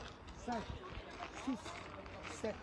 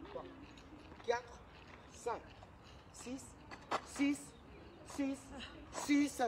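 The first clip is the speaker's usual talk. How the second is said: counting workout reps; 4 semitones higher, 7 dB quieter, slower than usual. Real, not acted.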